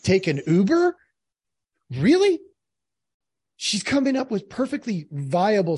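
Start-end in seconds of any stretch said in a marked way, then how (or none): 0.0-2.4 incredulous retort. Retold or imitated. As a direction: voice climbing into a disbelieving question. Acting out his scornful response.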